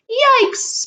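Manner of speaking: high sudden yikes; mock alarm. Shopkeeper pretending fright at the Spinosaurus, high yelp. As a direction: yelp at the scary dinosaur.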